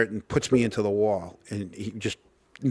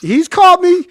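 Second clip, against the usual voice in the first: excited; 10 dB louder than usual. Real, not acted. Excited retelling to co-host.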